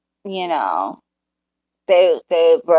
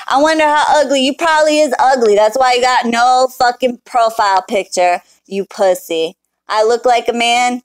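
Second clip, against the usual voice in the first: taunting, higher and louder; high, loud scornful taunt; 5 semitones higher, 3 dB louder than usual. Real, not acted. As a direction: sneer at the troll. Mocking the troll.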